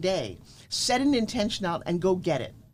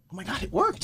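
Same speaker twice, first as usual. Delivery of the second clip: amazed disbelief. Retold or imitated. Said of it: "we were both like".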